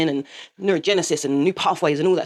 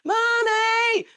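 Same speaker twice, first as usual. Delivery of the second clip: son's mock scream; much slower than usual. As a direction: imitate a son calling out for mum. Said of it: "my oldest son was screaming".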